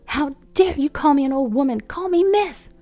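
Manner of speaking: high-pitched, outraged protest; comically furious mother; indignant outburst. A narrator's reading. Furious mother held back after insult.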